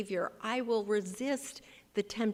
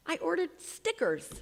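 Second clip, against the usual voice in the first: mock pride; 10 semitones higher than usual. Shown as a demonstration. Mock-proud small deed.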